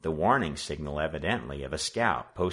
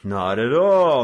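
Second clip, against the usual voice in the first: loud, slow, exaggerated courtesy; mock polite; 6 semitones higher, 12 dB louder, slower than usual. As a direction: play the gentleman with menace. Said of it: "the leader responded politely".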